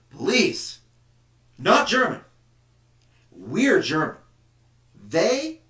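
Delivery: a jeering patriot listing the traitors; nationalist scorn. Acted. Voices nationalist dismissing opponents.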